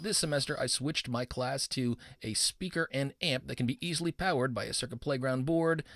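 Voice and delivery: normal talking voice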